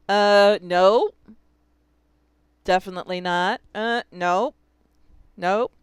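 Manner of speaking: loud playful rejections; teacher loudly rejecting wrong spellings for students; emphatic no. Shown as a demonstration. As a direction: knock each wrong spelling down firmly. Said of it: "rejecting wrong spellings aloud".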